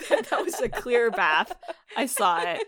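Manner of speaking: real laughter breaking into the words; laughing. Real, not acted. Joking protest about the bath scene.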